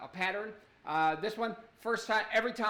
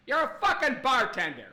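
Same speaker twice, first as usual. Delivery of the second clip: louder, higher exasperated retort; mock rebuke; 4 semitones higher, 5 dB louder than usual. Comic putting a self-styled mixologist in his place, exasperated retort. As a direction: punch it out with comic indignation.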